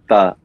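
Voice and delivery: ta as a separate "tuh"; isolated syllable. Shown as a demonstration. Musician repeating the syllable, ta as "tuh". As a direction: say ta alone, vowel as in "the". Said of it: "almost like how you would say the".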